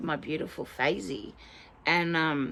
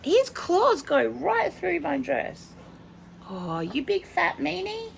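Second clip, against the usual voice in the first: playful complaint; 12 semitones higher than usual. Real, not acted. Complains about pet in high voice.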